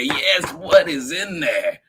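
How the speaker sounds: usual speaking voice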